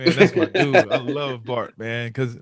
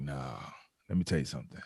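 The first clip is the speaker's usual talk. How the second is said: lower, softer raspy rasp; lower raspy veteran voice; 8 semitones lower, 7 dB quieter, faster than usual. Retold or imitated. Veteran quietly lets the rookie in on a secret, low and raspy. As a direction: lean in low and raspy, about to share a secret. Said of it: "he got this real raspy voice".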